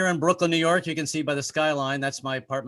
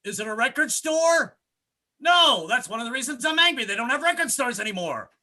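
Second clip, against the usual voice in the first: angry commercial; 10 semitones higher, 4 dB louder than usual. Pretend. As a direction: sell it like a shouting car-lot ad. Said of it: "write a commercial for myself".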